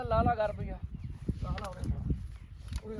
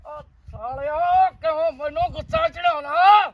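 loud furious yelling, dragged-out opening; furious shout; 3 semitones higher, 8 dB louder than usual. Acted. Scene: father yelling at his son to get lost, furious shout. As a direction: roar at him to get out of sight.

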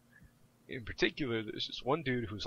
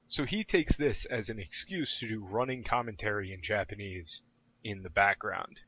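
ordinary delivery

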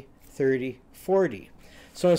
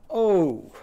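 groaned O; 4 semitones higher, 4 dB louder than usual. Shown as a demonstration. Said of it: "vowel review".

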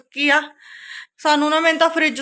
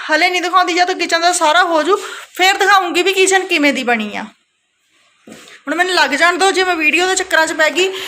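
energetic; 3 semitones higher, 4 dB louder than usual. Real, not acted. Energetic pep before cleaning.

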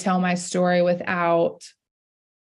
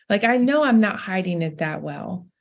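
usual speaking voice

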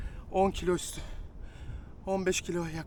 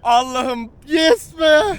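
joyful shout; 7 semitones higher, 8 dB louder, much slower than usual. Real, not acted. Celebrating the catch.

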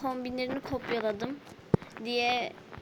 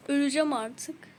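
ordinary delivery

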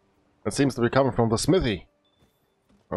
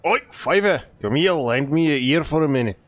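hammed-up bad Scottish accent for the blacksmith; a gruff-sounding mock Scottish smith calling the hunter over, lively; fake Scottish accent. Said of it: "I had to do that fake bad Scottish accent".

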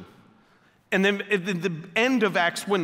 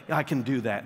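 creepy hitman; 8 semitones lower, 4 dB quieter than usual. Retold or imitated. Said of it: "they come in real creepy".

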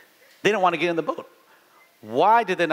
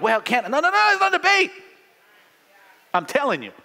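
parent cutting off debate; 9 semitones higher, faster than usual. Retold or imitated. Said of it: "They, they wanna debate".

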